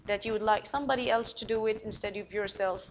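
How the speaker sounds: ordinary delivery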